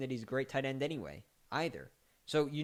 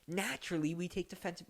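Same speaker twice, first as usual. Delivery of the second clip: lightly voiced smug front office man, higher; a hint of a mock general manager; mock Bears GM; 5 semitones higher, 4 dB quieter, faster than usual. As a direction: play the clueless team boss. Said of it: "mock voice of Bears front office".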